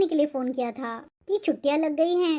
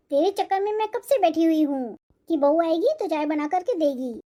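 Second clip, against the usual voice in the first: ordinary delivery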